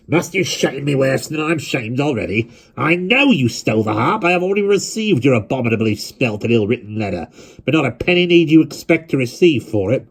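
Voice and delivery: lightly voiced stern elder scolding; stern old grandfather. A narrator's reading.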